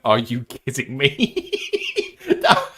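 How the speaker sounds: slow, laughing through the question; laughing disbelief. Real, not acted. Host reads viewer's praise of the Irish accent, laughing. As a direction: crack up while reading it out.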